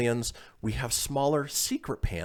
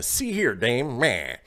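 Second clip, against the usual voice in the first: mock old-time tough guy; 2 semitones higher, 4 dB louder, slower than usual. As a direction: ham up a classy fedora dude. Voicing a classy fedora guy.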